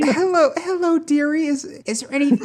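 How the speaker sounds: high, quavering granny voice; old lady voice. Acted. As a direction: play a frail old grandma. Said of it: "I was trying to think an old lady voice".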